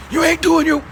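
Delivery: father scolding his son at lunch, mock scolding; dad scolding son. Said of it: "I could hear him like".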